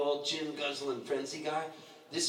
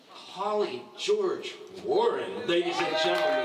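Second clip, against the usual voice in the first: drawn-out, louder stage introduction; announcer intro; 5 dB louder, slower than usual. Acted. Host bringing the next guest to the stage, announcer style. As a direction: call her up like an MC.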